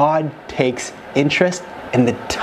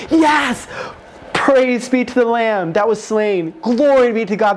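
joyful shout; 7 semitones higher, slower than usual. Retold or imitated. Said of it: "screaming and shouting for joy".

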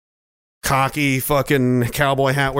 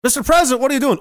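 a raised, alarmed aide voice; startled aide; 8 semitones higher, much faster than usual. Acted. Aide catching the president at something, alarmed.

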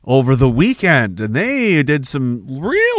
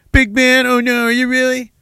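higher, sneering fake alarm; mocking taunt; 8 semitones higher than usual. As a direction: jeer like a schoolyard bully. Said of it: "taunt them in public".

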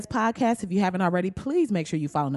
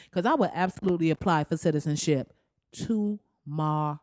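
ordinary talk

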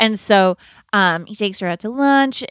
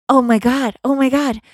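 amazed; 5 semitones higher, 8 dB louder than usual. Retold or imitated. Phil stunned the loop broke.

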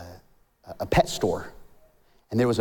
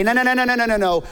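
insistent denial; 11 semitones higher, 5 dB louder, much faster than usual. Acted. Acting out refusal to believe.

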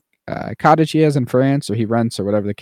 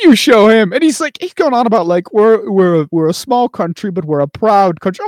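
high, loud hammed-up British pride; mock British speech; 10 semitones higher, 5 dB louder than usual. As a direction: ham up the proud small-country speech.

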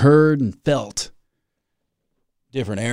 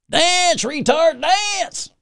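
taunting hippies; much slower than usual. Pretend. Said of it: "looking down at me like".

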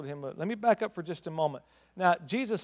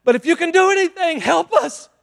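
much higher, louder cry for help; desperate plea; 11 semitones higher, 9 dB louder than usual. Acted. Father crying out to Jesus, desperate loud plea. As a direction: beg at the top of your voice. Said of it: "his father says".

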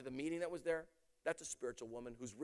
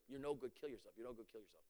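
hushed demon voice; 7 semitones lower, 14 dB quieter than usual. Retold or imitated. Preacher quietly repeating the voice that taunted the girl. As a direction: let the taunt sink to a near hush.

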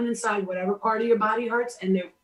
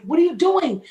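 sharp shouted demand, pitched up; retold shout; 7 semitones higher, 7 dB louder than usual. Confronting the man in her dream, shocked shout. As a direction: call out in shock and outrage.

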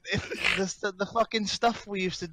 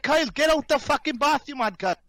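high raised voice, falling at the end; retold exasperated order; 7 semitones higher, 3 dB louder than usual. Friend orders Kyle out of the bath, exasperated shout. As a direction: re-enact ordering a mate out.

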